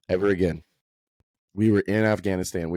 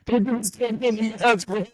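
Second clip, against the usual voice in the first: high voice squeezed by laughter; laughing hard; 10 semitones higher than usual. Real, not acted. Friend riffing on masks during covid, cracking up. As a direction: barely get it out for laughing.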